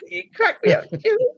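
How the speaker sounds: high-pitched laugh through words; cracking up while talking; laughing hard. Real, not acted. Laughing hard at friend's joke.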